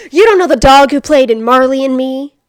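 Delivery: loud hammed-up incredulous sneer; mock a friend who can't believe your ignorance; loud mock astonishment; mocking disbelief.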